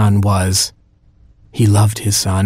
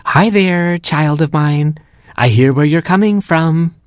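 put-on cooing to the baby; 7 semitones higher than usual. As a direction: talk down to the belly like a doting dad. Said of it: "spend a little quality time with my child".

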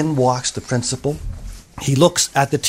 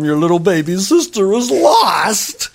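raised, wailing mock lament; distraught mom; 4 semitones higher, slower than usual. A narrator's reading. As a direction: stretch the last word into a cry. Mother laments the missing baby.

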